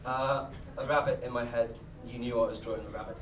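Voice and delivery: ordinary talk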